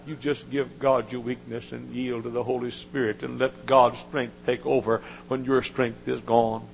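usual speaking voice

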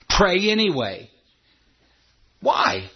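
ordinary talk

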